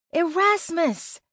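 high, drawn-out call. A narrator's reading. Searching the woods for a missing friend, slow high call. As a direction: stretch the name out as you call. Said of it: "I called".

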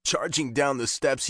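ordinary delivery

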